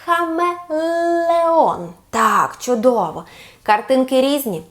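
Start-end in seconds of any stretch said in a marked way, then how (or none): 0.0-1.9 хамелеон drawn out syllable by syllable, high; very slow model word. Shown as a demonstration. Teacher says хамелеон very slowly for the child to repeat. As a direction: stretch хамелеон out for the child to copy. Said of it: "Повторимо разом".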